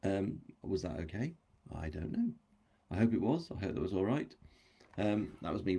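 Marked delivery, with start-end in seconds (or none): none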